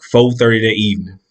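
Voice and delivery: usual speaking voice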